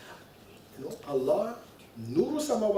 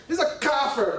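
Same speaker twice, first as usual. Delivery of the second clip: mock Salafi accent, pitched higher; emphatic Salafi accent; 5 semitones higher than usual. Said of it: "my most emphatic Salafi accent".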